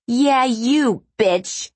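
high, loud, hostile challenge; angry shriek. A narrator's reading. Woman confronting a showgirl on the Strip, angry shriek. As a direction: yell it at the woman you hate. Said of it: "the woman's shriek caught Ruby's attention".

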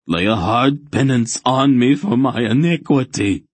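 high, loud zealot's plea; pleading. A narrator's reading. Frenzied fanatic begging for penance, pleading high and loud. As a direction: beg hard for penance. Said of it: "Relg pleaded".

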